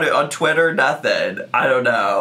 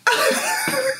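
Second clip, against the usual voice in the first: very high squeaky laugh; short squeal of real laughter; helpless laughter. Real, not acted. Vlogger caught off guard by the clip, squeaking with laughter. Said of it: "I can't stop laughing".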